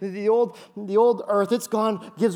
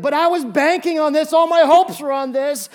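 loud, much higher wail of dismay; mock despair; 8 semitones higher, 9 dB louder than usual. Pretend believer crushed by the bad news, loud mock despair. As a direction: cry out as if all hope were lost.

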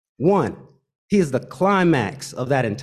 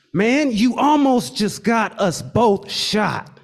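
slow, higher, exasperated; meltdown; 6 semitones higher, slower than usual. Retold or imitated. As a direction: lay it on him, shaken. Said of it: "He then proceeded to have a meltdown".

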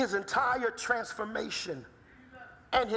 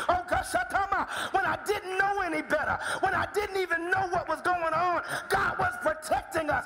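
building preaching; 7 semitones higher than usual. Acted. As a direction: let the sermon catch fire. Preacher builds to full force.

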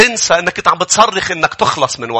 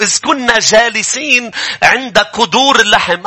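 raised reading voice; scripture reading; 4 semitones higher than usual. Acted. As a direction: proclaim the verse. (قراءة الآية بصوت مرتفع)

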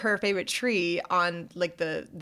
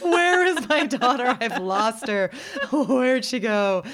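panicked mom; 5 semitones higher, 5 dB louder, slower than usual. Retold or imitated.

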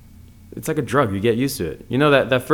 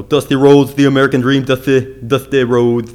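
Dusty Rhodes impression; 5 dB louder than usual. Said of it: "my Dusty Rhodes impression".

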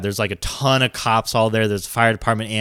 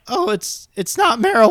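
nervous; 10 semitones higher than usual. Retold or imitated. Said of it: "you get, like, nervous".

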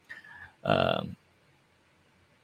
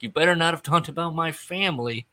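higher, pushy impression of a grumpy wrestler; mock threatening Dax; 6 semitones higher than usual. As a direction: needle him like a sulky tough guy. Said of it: "So he's saying things like".

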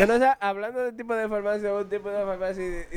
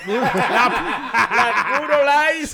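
laughing; 3 semitones higher, 6 dB louder, much slower than usual. Real, not acted. Laughing at the beer name.

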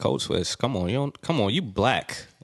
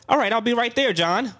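high, polished office voice; corporate work voice; 12 semitones higher than usual. Retold or imitated. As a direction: put on your proper voice for the boss. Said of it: "He was talking like".

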